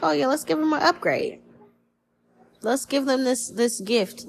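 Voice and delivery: a hint of eager gods handing out a gift, settling as it goes; lightly voiced generous gods. Gods pleased to upgrade their favorite, mildly voiced high then easing. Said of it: "They're like".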